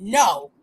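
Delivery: NO said low and firm; emphatic. Retold or imitated. Retelling the brother's answer, emphatic. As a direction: mimic an emphatic NO, dropped in pitch. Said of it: "He said it emphatically".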